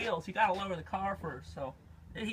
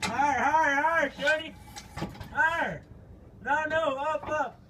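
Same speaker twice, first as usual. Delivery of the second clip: calling out; 7 semitones higher, 3 dB louder than usual. Real, not acted. Calling out while car is jacked.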